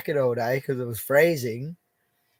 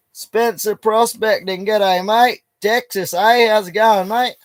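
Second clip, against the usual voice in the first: higher and louder, a bright g'day to a viewer; cheery shout-out; 7 semitones higher, 7 dB louder, slower than usual. Real, not acted. Streamer greeting viewer Spencer, loud cheery hello.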